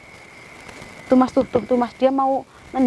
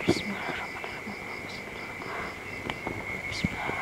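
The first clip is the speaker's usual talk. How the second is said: whispered prayer; 3 semitones lower, 15 dB quieter than usual. Real, not acted. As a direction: breathe the words out to calm it. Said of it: "Saya bisikin ya".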